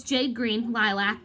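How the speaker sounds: ordinary delivery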